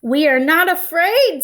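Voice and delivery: high, loud, defiant scream; screamed together. Acted. Frog and Toad scream at the hawk, loud and high. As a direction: yell it bravely while scared. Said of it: "screamed Frog and Toad".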